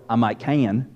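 low, soft Southern "can" in two syllables. Shown as a demonstration. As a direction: drop low and stretch "can" into ca-yun. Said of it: "you can turn one-syllable words into two-syllable words".

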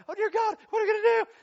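frantic cry of alarm; mock panic. Church facing the building bill: loud high panicked cry. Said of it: "And then you're like".

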